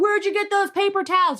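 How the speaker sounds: squeaky eager asking; high mock question. Asking shoppers where the towels are, high mock voice.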